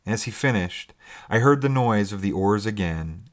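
ordinary delivery, nothing marked

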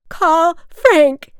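high, very loud yell down a stairwell; full-voiced, high-pitched drunken scream; shriek. A narrator's reading. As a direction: shriek it out desperately. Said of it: "she shrieked".

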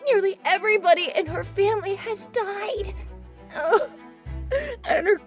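put-on high, tearful, breathless child; a scared girl crying between words; frightened little girl. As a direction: tell it as if scared of the dark. Frightened girl tells ghost story.

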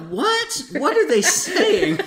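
high-pitched, incredulous mock outburst; baffled disbelief. Host retelling his reaction to the ad, comically baffled. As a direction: squeal it as if totally baffled, laughter close behind. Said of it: "And I'm like".

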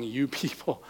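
"you people" said slowly and pointedly, both words underlined; stress on you people. Retold or imitated. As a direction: lean hard on "you people", quiet but cutting.

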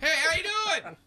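high, loud goofy voice for his penis; a boy's penis greeting him cheerfully; voicing a penis. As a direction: play the penis as a chirpy buddy.

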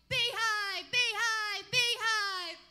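loud, high mock yell. [name] mimics Mary yelling as she runs up. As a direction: shout it from a distance. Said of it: "she's yelling".

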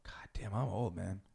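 unvoiced self-mocking mutter; whispered aside. Real, not acted. Guest admitting his age, a whispered self-mocking aside. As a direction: breathe it under your voice as an aside.

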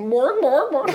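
high mechanical dog barks; robot dog. Pretend. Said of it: "Here's my impression of a robot dog".

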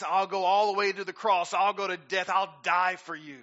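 loud, earnest vow; Peter vowing. Retold or imitated. Preacher voicing Peter promising Jesus, loud vow. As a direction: swear loyalty with fire. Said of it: "he told Jesus".

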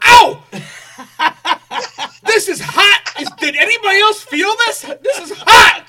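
mock pain. Man pretending the church's holy water burns him, yelping with laughter. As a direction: fake getting scalded, loud and laughing.

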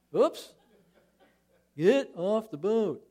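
hammed-up order to bail out of the boat; playful high call; playful shout. Boat rocking joke.

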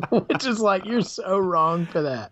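high, helpless laughter through the words; laughing. Real, not acted. Podcaster cracking up at the friend's movie line, laughing. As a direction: crack up while talking, pitch jumping up.

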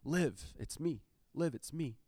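soft, hushed reassuring dad; calm her in the dark, gently. Retold or imitated. Father soothing his scared daughter at night, soft and reassuring. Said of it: "until I say".

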